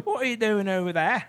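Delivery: high-pitched mock Australian; fading, badly faked Australian accent. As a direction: try Australian and let it slip away. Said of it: "That, it went away at the end".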